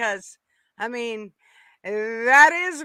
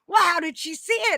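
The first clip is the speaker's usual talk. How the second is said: quoting Yank; 8 semitones higher, 4 dB louder, faster than usual. Retold or imitated. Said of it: "He's like".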